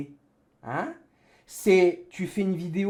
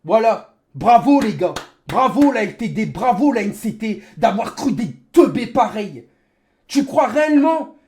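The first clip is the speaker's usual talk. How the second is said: rage-filled sarcastic shouting; raging gamer; 6 dB louder than usual. Real, not acted. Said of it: "c'est ma rage qui parle".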